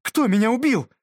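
high, loud sharp question; sharp demand. A narrator's reading. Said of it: "резко спросила беска".

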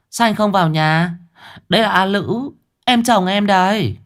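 high, light woman's voice; young woman Hoàng Anh. Acted. Hoàng Anh calling her brother inside, high and light.

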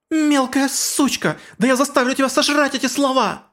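very high furious shriek; furious scream. Acted. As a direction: scream it in a rage. Shalltear explodes in fury.

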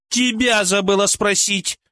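высоко, медленно, пренебрежительно; отрезать с презрительным фырканьем; фыркая. Acted. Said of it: "фыркнула Ольга". (пренебрежительно фыркнув)

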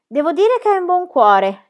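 high, loud yell; shrieked reply. A narrator's reading. Pippi yelling back to Book from cave, high shriek. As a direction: yell back a cheeky answer. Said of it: "gli strillò Pippi in risposta".

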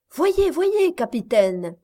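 urging the captain to look; eager cry. A narrator's reading. Miss Campbell points out the strange shape, excited. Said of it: "dit Miss Campbell".

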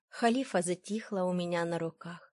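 ordinary delivery

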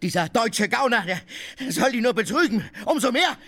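shouting in rage. Acted. Tarantjew yelling insults at Oblomow. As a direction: yell it out for all to hear. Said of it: "Ich will schreien!".

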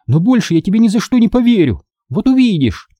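put-on high, miffed girl's vow; offended huff. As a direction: declare it high, hurt. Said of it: "фыркнула она обиженно". (обиженно, высоким голосом)

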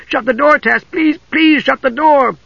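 high, drawn-out frightened begging; panicked plea. A narrator's reading. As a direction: beg desperately, pitch way up. Usha begs in terror.

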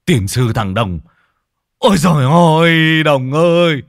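loud, high lament building to a long-held cry; furious wailing shout. A narrator's reading. Old man grabs Đồng over his poisoned dog, furious wailing. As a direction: curse him and wail at full voice.

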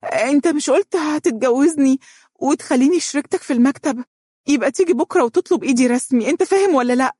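very high, insistent indignation; angry demand. A narrator's reading. Woman demanding her lover propose tomorrow, in heated indignation. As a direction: press him hard, brook no refusal.

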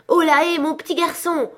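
loud, high holler; calling out. A narrator's reading. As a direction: hail someone down in the street. Scrooge calls down to the boy. (appel lancé fort, voix aiguë)

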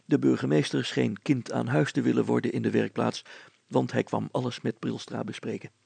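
ordinary delivery, nothing marked

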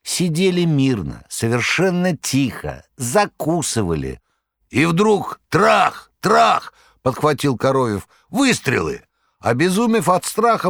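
high, theatrical storyteller voice; a buffoon spinning a breathless yarn, high; Koroviev's tale. A narrator's reading. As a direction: Koroviev dramatically retells the fire to his master.

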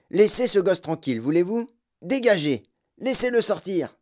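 high, loud, indignant orders; shouted commands. A narrator's reading. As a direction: shout the crowd aside. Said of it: "cria le gros boutiquier".

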